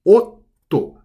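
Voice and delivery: otto with a short stop held before the T; double consonant. Shown as a demonstration. Teacher repeating the double T for learners, careful demo. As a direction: otto with a clear pause in the middle. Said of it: "you have to pause briefly before saying".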